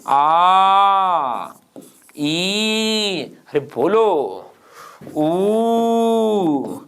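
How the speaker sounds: each vowel आ ई ऊ ए held long and steady; prolonged vowels. Shown as a demonstration. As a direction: chant the vowels, each stretched to three beats. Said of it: "तो प्लुत कौन कौन से होंगे". (हर स्वर को लंबा खींचकर बोलना)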